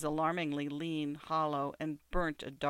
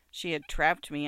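ordinary delivery, nothing marked

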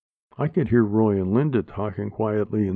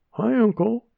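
put-on child voice; 12 semitones higher than usual. Child greets uncle brightly.

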